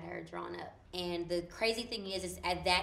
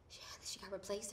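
panicked whisper; 10 dB quieter than usual. Retold or imitated.

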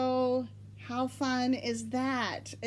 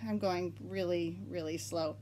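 ordinary talk, nothing marked

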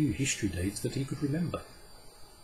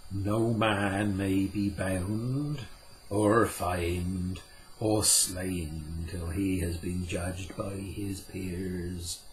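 Puck, slow and solemn; much slower than usual. Acted. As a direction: lay down the old law slowly and gravely. Puck's voice in the story.

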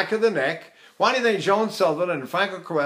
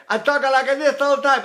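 higher-pitched Italian-accented English; 6 semitones higher, 3 dB louder than usual. Shown as a demonstration. Teacher puts on an accent, higher Italian-accented English. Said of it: "speak English with an Italian accent".

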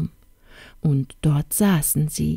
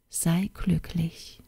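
very soft and slow; hushed wish; 8 dB quieter, slower than usual. Acted. Youth closing his poem to the princess, hushed wish. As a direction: let the wish fade, drawn out and quiet.

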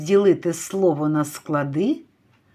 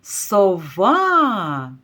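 сова with the final А held long and falling; calling, stressed А. Shown as a demonstration. Teacher demonstrates stressed А, calling сова with it stretched. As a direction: call сова, leaning on the last vowel. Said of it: "Наголошений голосний А".

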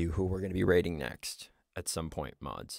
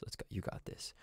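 whispered pep talk; 10 dB quieter than usual. Real, not acted. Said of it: "pep talk to self".

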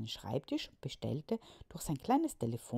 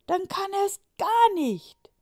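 put-on high, loud chorus of boys; boys shouting; 16 dB louder, slower than usual. Boys answering Juliane, high loud shout.

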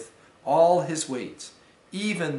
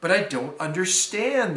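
ordinary delivery

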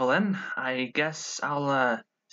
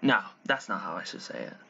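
normal talking voice

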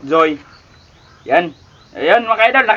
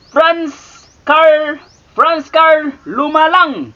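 careful slow reading; 5 semitones higher, much slower than usual. Shown as a demonstration. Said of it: "careful name reading".

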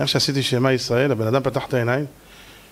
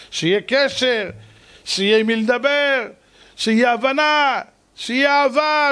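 fervent preaching; 10 semitones higher than usual. Acted. Preacher urging his congregation toward a loving home, fervent preaching. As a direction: lift the congregation, rising.

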